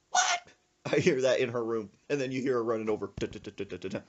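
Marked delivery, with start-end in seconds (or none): 0.1-0.4 high, loud indignant grandma; high mock outrage. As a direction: shriek it like an offended granny. Grandma hears insult.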